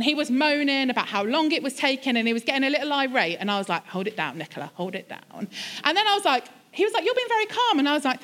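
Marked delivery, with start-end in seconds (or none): 3.9-5.5 low, softer word to herself; keeping a lid on it, lower; restrained self-talk. Retold or imitated. Muttered self-talk to keep her temper. 7.0-7.8 much higher-pitched quoted remark; high-voiced imitation of a stranger; imitated bystander. Voicing the man in the queue.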